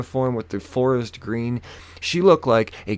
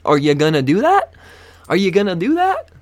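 raised, rising mock question; shrugging challenge; 8 semitones higher, 6 dB louder than usual. Passenger shrugging at the ticket threat, raised mocking question. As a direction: ask it like a taunting shrug. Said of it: "I give her the old, like, shoulder shrug".

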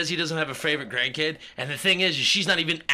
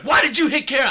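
higher, louder scolding demand; angry dad; 10 semitones higher, 7 dB louder, faster than usual. Retold or imitated. Retelling dad scolding him for hitting Kara, louder. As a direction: demand it like a furious father. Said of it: "He's like".

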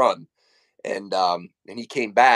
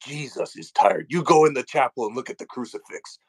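stern sister scolding; 2 semitones higher, 4 dB louder than usual. Retold or imitated. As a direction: lay down the law like a strict sister. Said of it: "And she goes".

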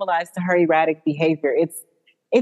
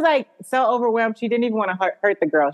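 usual speaking voice

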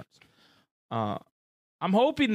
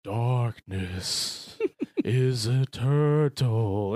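slow, low menacing line; slow villain voice; 5 semitones lower, much slower than usual. Retold or imitated. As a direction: drag it out like a villain. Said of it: "And he's like".

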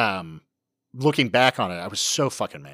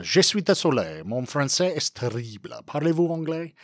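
French phrase; 5 semitones higher than usual. Shown as a demonstration. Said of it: "my, my go-to phrase is".

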